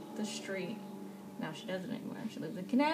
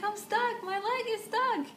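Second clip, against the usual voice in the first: high-pitched comic wail; mock distress, pitched way up; retold panicked plea; 11 semitones higher than usual. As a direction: whine it high, as if helplessly caught. Retells her panicked plea.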